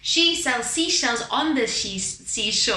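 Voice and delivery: slow, exaggerated "She sells seashells"; over-the-top pronunciation. Shown as a demonstration. Teacher gets muscles working, overpronouncing "seashore". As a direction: overpronounce every sound in "seashells" and "seashore", slowly. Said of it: "Over the top".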